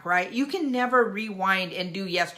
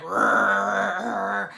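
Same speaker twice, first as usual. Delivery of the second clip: playful imitated dog growl; 4 dB louder than usual. Grandma retelling the dog's play with the ball, a growl. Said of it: "he was like".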